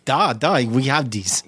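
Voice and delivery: light mock Russian waiter; lightly voiced Russian accent. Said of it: "Russian accent talk".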